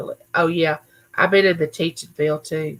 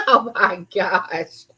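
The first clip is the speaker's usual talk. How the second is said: laughing; 3 dB louder, much slower than usual. Real, not acted. Laughing at the comment.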